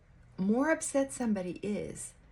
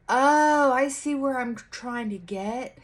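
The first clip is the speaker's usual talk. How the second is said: realizing oh; 5 semitones higher, 7 dB louder, slower than usual. Retold or imitated. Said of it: "your own thoughts and go".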